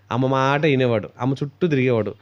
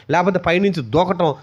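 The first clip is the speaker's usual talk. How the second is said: usual speaking voice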